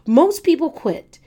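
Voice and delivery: high, loud, hammered repeat; preaching loud. Real, not acted. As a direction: punch it out again, loud.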